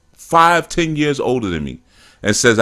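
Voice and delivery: ordinary talk, nothing marked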